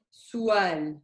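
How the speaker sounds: su'al run together as "suwal"; without the hamza. Shown as a demonstration. Teacher showing the word with no hamza, smooth glide. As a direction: glide slowly from u to a in su'al, no catch. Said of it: "If there was no hamza".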